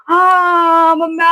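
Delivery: high, slow, drawn-out frightened wail; scared wail. Retold or imitated. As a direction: stretch it into a panicked cry. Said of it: "जोर जोर से चिल्ला रही हूँ".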